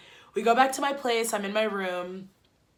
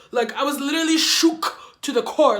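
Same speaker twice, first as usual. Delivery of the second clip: dramatic shook; 4 semitones higher, 6 dB louder than usual. Real, not acted. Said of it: "dramatic emphasis on being shook".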